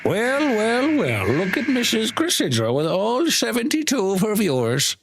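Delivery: high, drawn-out put-on voice; hammed-up high-pitched taunting welcome; mock-haughty greeting. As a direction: tease them with an exaggerated, slow "well, well, well". Teasing mock greeting to another streamer.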